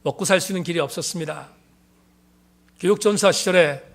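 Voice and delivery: normal talking voice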